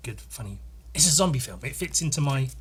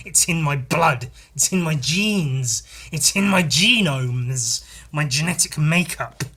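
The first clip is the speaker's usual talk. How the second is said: slow emphatic boast; 4 dB louder, slower than usual. Real, not acted.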